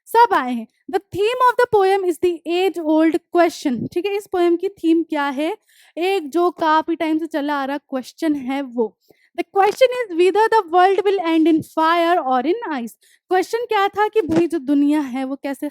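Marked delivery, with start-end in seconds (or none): 0.9-3.9 deliberate dictation pace, each word spaced; slow textbook reading. Acted. Teacher reading the poem notes aloud to students, slow and deliberate. Reads textbook line slowly. 9.4-12.9 deliberate dictation pace, each word spaced; slow textbook reading. Acted. Teacher reading the poem notes aloud to students, slow and deliberate. Reads textbook line slowly.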